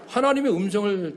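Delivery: ordinary delivery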